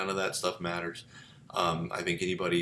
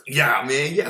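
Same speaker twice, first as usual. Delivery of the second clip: loud, higher street-slang imitation; mocking a slangy speaker, louder and higher; 5 semitones higher, 9 dB louder, slower than usual. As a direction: parody casual slang talk. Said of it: "I'll just hear somebody like".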